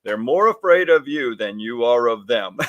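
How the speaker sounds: ordinary talk, nothing marked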